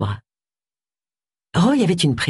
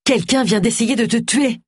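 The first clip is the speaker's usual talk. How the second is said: urgent, higher-pitched insistence; ordering; 6 semitones higher than usual. A narrator's reading. Agatha warns Roy someone shot at him, urgently insistent. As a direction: press the danger on him, pitch lifted. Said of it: "ordonna Agatha".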